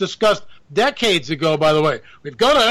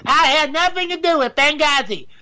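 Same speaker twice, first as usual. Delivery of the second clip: higher, a put-on Hillary Clinton voice; retry the Hillary line in a higher, changed voice; Hillary Clinton impression; 7 semitones higher than usual. Vlogger retries Hillary Clinton impression, higher put-on voice. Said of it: "I can't do Hillary Clinton".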